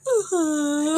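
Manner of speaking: milk the pretend tears; fake crying. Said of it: "starts to pretend to cry".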